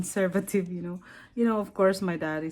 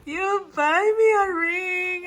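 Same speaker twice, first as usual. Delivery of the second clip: teary; 8 semitones higher, slower than usual. Real, not acted. Wife given surprise ring.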